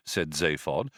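ordinary delivery

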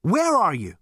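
high, loud, urgent shout; panted demand. A narrator's reading. Zaphod searching for the voice, panting alarm. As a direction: cry out to someone unseen. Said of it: "panted Zaphod".

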